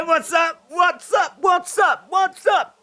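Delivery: high, shouted chant; catchphrase shout. Retold or imitated. Fan echoing "You say, what's up", high shouted chant. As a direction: call it out high like a crowd chant.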